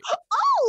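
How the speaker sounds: squeaky gasp of fake delight; high mock gasp. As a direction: gasp as if amazed.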